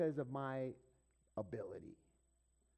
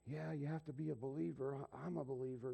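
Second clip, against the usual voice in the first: softer, subdued imitation; toned down; 5 dB quieter than usual. Store clerk conceding to the customer, toned down. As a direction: back off into a quieter admission. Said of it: "he kinda toned down a little bit".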